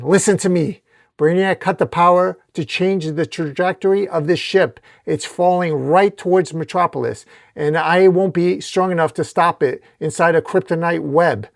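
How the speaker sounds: sustained hero urgency; urgent warning. Acted. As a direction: press a dire warning on an ally. Superman urgently warns of falling ship.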